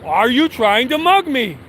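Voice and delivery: mock-loud confrontation, stretched high; retold loud challenge. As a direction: re-enact a public call-out, pitch leaping up. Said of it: "I said it really loud".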